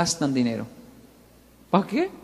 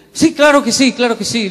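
much higher and louder, overeager yes; eager bluff; 5 semitones higher, 8 dB louder than usual. Retold or imitated. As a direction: jump in fast before he changes his mind. Woman bluffing to the manager. (entusiasmo exagerado para no perder el pedido)

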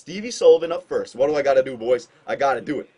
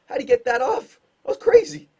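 amazed; 11 semitones higher, 3 dB louder than usual. Real, not acted. Amazed at goalie's quick hands.

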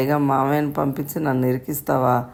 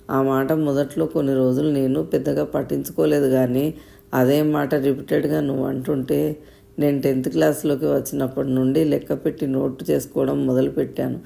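usual speaking voice

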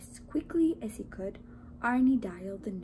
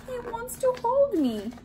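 high, happy little donut voice; delighted donut; 13 semitones higher, 5 dB louder than usual. A narrator's reading. Donut thinks he will be cuddled, delighted high voice. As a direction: coo it high, pleased to be held. Arnie's happy thought, being lifted.

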